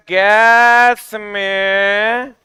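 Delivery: slow, stretched lead-in for the class; drawn-out prompt. Shown as a demonstration. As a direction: stretch "गैस में", leaving the answer open. Stretches words for class to complete. (खींचकर, जवाब पूरा करवाते हुए)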